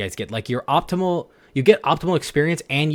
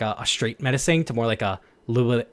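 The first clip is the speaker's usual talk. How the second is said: normal talking voice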